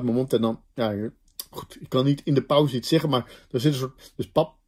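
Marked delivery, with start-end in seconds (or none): 4.2-4.6 papa with the p held long and exaggerated. Shown as a demonstration. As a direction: overdo the closed-lip pause in papa. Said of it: "Ik overdrijf nu een beetje".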